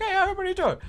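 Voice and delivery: mock the manager's breezy hello; high chirpy manager. The manager breezing past the tables, high and chirpy.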